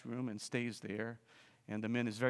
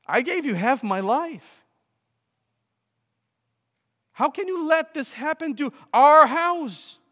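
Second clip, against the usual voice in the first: shouting in outrage, much higher and louder; furious shout; 14 semitones higher, 13 dB louder than usual. Acted. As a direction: yell it at Jesus like a betrayed homeowner. Angry homeowner confronts Jesus.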